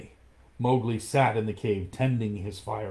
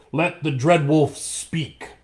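louder, slow booming call; thundering; 6 semitones higher, 7 dB louder, slower than usual. Acted. Elder wolves demand Akela speak, loud and slow. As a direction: boom it like old wolves. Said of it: "the seniors of the pack thundered".

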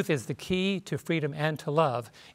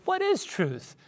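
a lightly voiced Pilate, much higher, scornful question; 14 semitones higher, slower than usual. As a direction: toss it off high like a skeptical Pilate. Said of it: "Like Pilate says".

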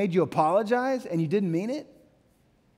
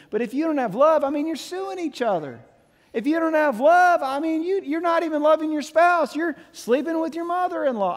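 preaching at full force; 9 semitones higher than usual. Acted. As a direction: plead with the church, pitch raised high. Passionate preaching peak.

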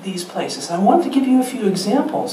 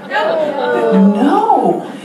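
emphatic no; 6 semitones higher, 4 dB louder than usual. Acted. Mock answer to own question.

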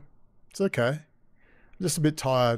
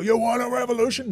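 mocking Alex Jones; 9 semitones higher, faster than usual. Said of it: "And Alex Jones".

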